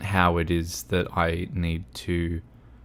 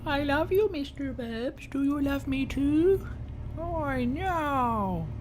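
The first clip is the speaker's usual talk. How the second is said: tiny, very high, cutesy voice; pet-talk voice; 7 dB quieter, slower than usual. Pretend. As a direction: squeak it softly like baby talk to a dog. Voices sweet talk with a dog.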